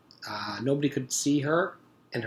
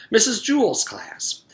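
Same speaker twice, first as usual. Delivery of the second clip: sudden recall; 11 semitones higher, 8 dB louder than usual. Acted. Allison remembers suddenly.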